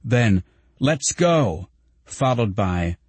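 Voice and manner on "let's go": higher-pitched cheering call; mild narrated shout. A narrator's reading. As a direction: urge the band on, without full volume. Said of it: "shouting in an enormous voice".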